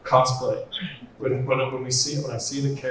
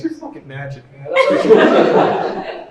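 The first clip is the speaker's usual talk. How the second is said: laughing praise; 3 semitones higher, 10 dB louder, much slower than usual. Retold or imitated. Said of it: "I'm like".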